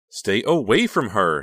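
loud, high, slow frantic cry; wild cry. A narrator's reading. Honey crying out to keep Bill from Becca. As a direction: cry out wildly. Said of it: "she cried".

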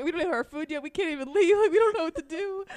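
comically helpless outburst; mock panic. Acts out their stranded dilemma.

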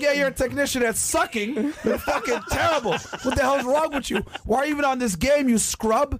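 high, laughing taunt of a gamer; mocking trash talk. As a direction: roast him in a squeaky mock voice. Retells his trashtalk.

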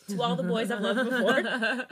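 loud, slow, breaking up with laughter; laughing. Real, not acted. Reader cracking up at a film-title line in the confession, laughing. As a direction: crack up while reading the line.

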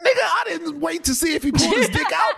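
high-pitched, laughing protest; real, high incredulous yelp; incredulous outburst. Real, not acted. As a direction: shout it in disbelief, half laughing. Incredulous answer to co-host's question.

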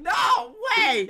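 loud, high "no way!"; outraged no-way shout; shouted disbelief. Real, not acted. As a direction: yell it in disbelief. Shocked disbelief at friend.

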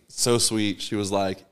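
ordinary talk, nothing marked

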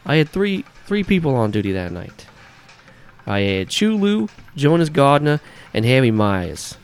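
hammed-up New Yorker listing his drivers; New Yorker accent. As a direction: bark the roster in a thick New York accent. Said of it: "this shitty New Yorker accent".

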